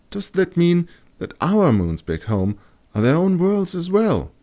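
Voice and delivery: put-on high, curious girl; high wondering girl. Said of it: "Kat wondered".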